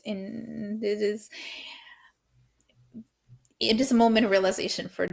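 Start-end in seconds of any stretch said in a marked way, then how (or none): 0.1-1.3 hushed whisper. Real, not acted. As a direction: drop to a whisper. Hushing before revealing the ending.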